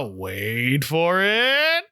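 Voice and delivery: slow drawn-out wait for it. Retold or imitated. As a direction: stretch it out slowly. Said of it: "Tony lets out a".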